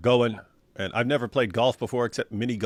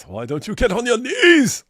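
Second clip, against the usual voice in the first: higher, louder mocking divine voice; mysterious voice; 10 semitones higher, 7 dB louder than usual. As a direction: deliver it like a voice from above, hammed. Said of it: "I heard a voice".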